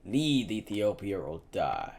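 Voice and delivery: slow, menacing final threat; soldier's cold final warning; dropped voice. A narrator's reading. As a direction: spell out the ultimatum slowly. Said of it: "he dropped his voice".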